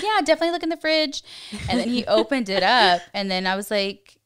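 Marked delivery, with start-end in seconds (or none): 0.0-1.2 rushed. Retold or imitated. Insisting he look in fridge.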